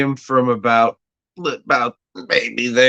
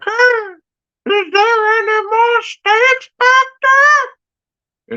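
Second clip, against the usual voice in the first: high, slow falsetto lady; lady's voice; 5 dB louder, slower than usual. Retold or imitated. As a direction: call it sweetly up in a high lady voice. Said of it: "the lady's like".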